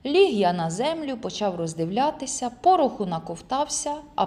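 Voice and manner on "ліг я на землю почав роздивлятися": ordinary delivery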